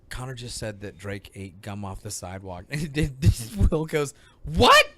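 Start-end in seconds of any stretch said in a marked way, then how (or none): 0.1-2.7 low, hushed retold admission; mortified quiet confession. Mortified dad telling Will about the gum, hushed retelling. 4.4-4.9 high, loud acted disbelief; mock shocked outburst. Retelling Will's reaction to the gum news, mock shock.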